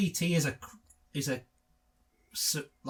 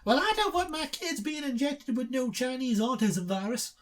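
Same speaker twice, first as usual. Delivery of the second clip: anti-vaxxer mum voice; 8 semitones higher than usual. Acted.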